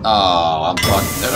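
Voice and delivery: ordinary talk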